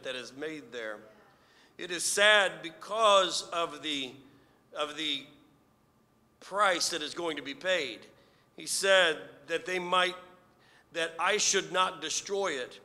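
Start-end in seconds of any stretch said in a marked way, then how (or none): 1.8-12.8 rising preacher's fervor; preaching. Acted. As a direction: preach it with building force. Preaching builds in force.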